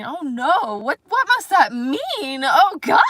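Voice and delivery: high, loud, prim panic in a put-on British voice; uptight British inner voice. Mocking the inner critic who fears the video runs long, high and loud. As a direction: squeal it like a fussy British perfectionist. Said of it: "an uptight British person in my".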